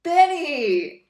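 loud, high drawn-out cry of a name; hammed-up anguished shout; acted outcry. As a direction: call out the betrayer's name.

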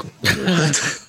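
high shocked "what?"; surprised. Retold or imitated. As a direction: squeak it out in disbelief. Shocked reaction in retold story.

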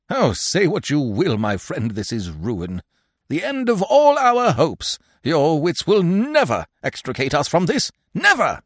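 high, loud, hammy lament; frenzied despair. A narrator's reading. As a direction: declaim ruin like a stage tragedian, soaring up. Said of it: "A frenzy of despair vibrated in his accents".

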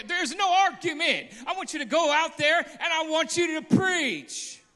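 loud, high preacher cry; preaching at full force. Acted. Preacher urging the church to go out, shouting. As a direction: thunder it to the congregation.

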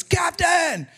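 high, stretched call from far above; hollering. Acted. Lookout in the crow's nest to his captain, hollering. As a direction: holler down to the deck, pitch way up. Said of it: "he hollered out, he goes".

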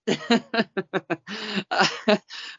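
long high-pitched laughter; high giggling laugh. Real, not acted. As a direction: burst into helpless giggles. Big laugh at co-host.

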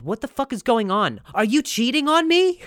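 rising to a high, loud accusation; hammed-up suspicion climbing into a squeal; mock outrage. Girlfriend accusing fake boyfriend of cheating, outraged. Acted accusation over text.

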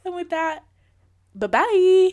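high, loud singsong farewell; big sign-off. Acted. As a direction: belt out a drawn-out goodbye. Exaggerated podcast sign-off.

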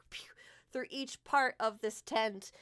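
ordinary talk

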